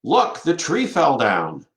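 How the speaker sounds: mock surprise. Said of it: "someone says".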